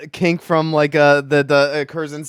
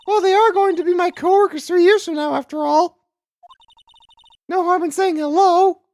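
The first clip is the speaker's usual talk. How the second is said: put-on squeaky, very high young-character voice. Emma defends greeting her future coworkers, high squeaky voice. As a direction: lift the whole line into a high squeak. Voicing Emma in game dialogue.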